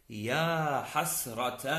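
"حسرة" drawn out as hasrataa; slow demo of "حسرة" with open final aa; long final alif. Shown as a demonstration. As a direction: slowly end "حسرة" on -taa. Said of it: "هذه الألف".

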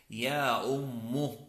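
"أم" drawn out as ummu; slow demo of "أم" with u after doubled m; damma on last letter. Shown as a demonstration. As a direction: slowly end "أم" on a u. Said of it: "بضم الحرف الأخير".

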